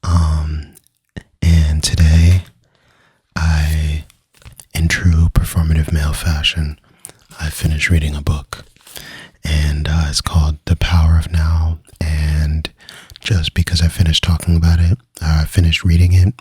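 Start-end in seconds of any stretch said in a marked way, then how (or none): 1.4-12.7 asmr whisper. Acted. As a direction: whisper close into the mic.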